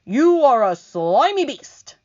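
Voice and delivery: loud, slow, high insult. Acted. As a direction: spit out the insult. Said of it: "answered the centipede".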